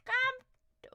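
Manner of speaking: high put-on little voice; put-on squeaky voice. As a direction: pitch it way up, playfully. Voicing a chat message.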